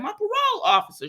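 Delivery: very high, quick exasperated quip; mock indignation. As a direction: toss it out high and fast, mock-offended. Mimicking a complaint about Bill.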